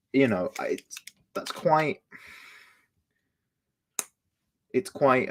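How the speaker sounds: talking while chewing; words round a mouthful of snack; mouth full. Real, not acted. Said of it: "Welcome to my mukbang".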